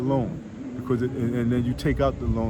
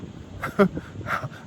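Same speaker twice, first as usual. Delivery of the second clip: breathy laugh; 8 semitones higher than usual. Real, not acted. Laughs at checking own.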